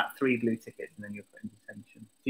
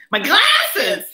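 shout; 11 dB louder, much slower than usual. Real, not acted. Can't find her glasses.

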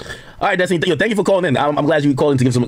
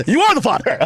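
higher, laughing talk-show verdict; mock paternity-test announcement; Doctor Phil; 12 semitones higher than usual. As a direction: deliver the big reveal like a TV host, cracking up. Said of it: "I could be the Doctor Phil".

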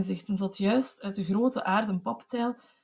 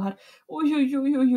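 higher, moaning oei; dying wail; 4 semitones higher, 3 dB louder than usual. A narrator's reading. Fallen mother wailing oei, weak dying moan. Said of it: "herhaalde onophoudelijk haar akelig gehuil".

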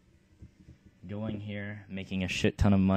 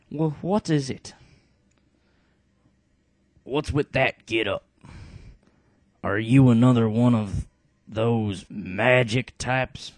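dim heavy brute; 3 semitones higher, 7 dB louder than usual. Acted. Bartray quizzing the shaman, loud dim brute voice. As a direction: play the stupid heavy, loud and blunt. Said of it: "Bartray will be the, the heavy, um, the stupid character".

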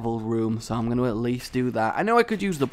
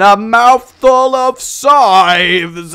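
very loud, high, stretched-out announcement; drawn-out victorious yell; gleeful shout; 9 semitones higher, 13 dB louder, much slower than usual. Real, not acted. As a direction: holler it slowly in triumph. Gleefully announces item bought.

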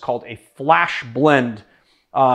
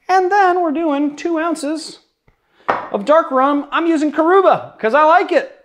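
silly high voice; 10 semitones higher than usual. Acted.

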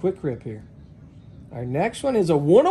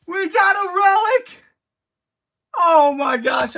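near tears; 5 semitones higher than usual. Real, not acted. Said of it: "I, I'm crying".

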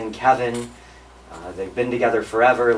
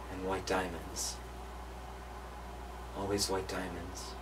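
hushed, solemn storytelling; 13 dB quieter than usual. Real, not acted. Grandchild recalls holding dying grandmother's hand, hushed and solemn. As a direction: quietly relive a bedside vigil.

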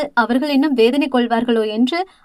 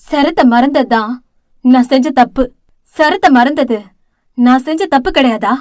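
louder, each word pressed hard; firm, pressing voice; 5 dB louder than usual. Acted. Elder sister firmly insists to her younger sister, louder and pressing. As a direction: insist on it, leaning on every word. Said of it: "அழுத்தமான குரலில்".

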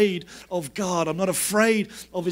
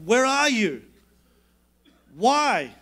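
mildly raised, drawn-out plea; retold crying out to God; 3 semitones higher, 3 dB louder, slower than usual. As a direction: call out to an absent God, as a preacher retelling it. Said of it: "out loud. Like, he's saying".

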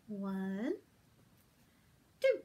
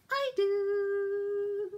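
high puppet voice; 8 semitones higher, 4 dB louder than usual. Acted. Puppet answering.

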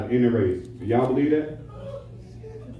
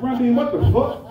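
mock outrage; 10 semitones higher, 3 dB louder than usual. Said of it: "I said".

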